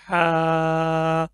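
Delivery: हा breathed lightly from the middle of the throat; a thin, airy हा; slow clear हा. Shown as a demonstration. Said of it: "हलक का दरमियान वाला हिस्सा है".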